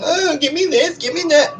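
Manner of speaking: high, whining mock voice of a spoiled complainer; whiny brat. Mocking a whiny person who begs for everything, high and whining. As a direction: whine it like a demanding little brat. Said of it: "I could be really whiny".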